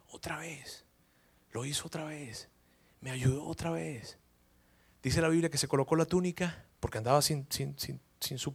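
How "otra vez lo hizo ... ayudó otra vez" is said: whispered, soft and slow; awed whisper. Retold or imitated. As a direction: breathe it out in wonder. Pedro awed at shore.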